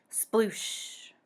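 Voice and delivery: usual speaking voice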